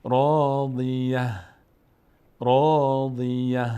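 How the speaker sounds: راضیه drawn out, closing on ه, no t; pausal ha ending. Shown as a demonstration. Teacher showing the pause form, slow chant ending راضیه on ه. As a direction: chant راضیه slowly and stop on the ه.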